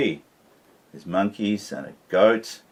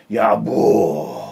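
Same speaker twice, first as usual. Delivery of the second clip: mock shout; 2 semitones higher, 7 dB louder than usual. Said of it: "began to shout".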